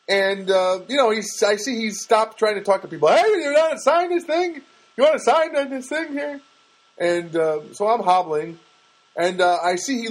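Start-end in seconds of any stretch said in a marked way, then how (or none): 3.1-6.4 much higher, nagging street canvasser; pushy petition guy. Retold or imitated. Petition guy at the store door, hustling for signatures in a put-on voice. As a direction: hustle strangers to sign, in a put-on voice. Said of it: "he's gonna talk like this".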